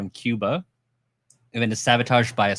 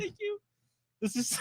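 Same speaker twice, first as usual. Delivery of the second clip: giggly; 11 dB quieter than usual. Real, not acted. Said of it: "I'm laughing because".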